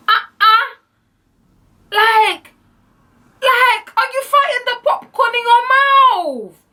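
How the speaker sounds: very high, loud squawk; hammed-up, high-pitched incredulous shout; outraged disbelief. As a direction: squeal it slowly in exaggerated outrage. Outraged at his disgusting eating.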